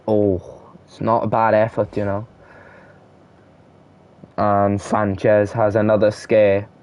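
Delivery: ordinary talk, nothing marked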